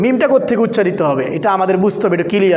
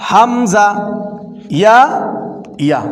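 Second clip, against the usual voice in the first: reciting the alphabet; much slower than usual. Shown as a demonstration. Teacher reciting Arabic letters for learners to repeat. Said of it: "উচ্চারণগুলো খেয়াল করেন".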